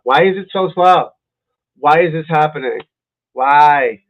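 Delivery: exasperated outburst at faulty tech; real exasperation. Real, not acted. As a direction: demand answers from a failing computer, loudly. Exasperated at failing stream.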